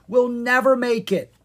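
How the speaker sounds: loud, high cry of despair; alarmed cry. A narrator's reading. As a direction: cry out that all is lost. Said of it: "Zed cried".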